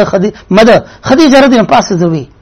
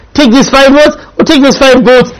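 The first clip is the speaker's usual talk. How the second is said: ordinary talk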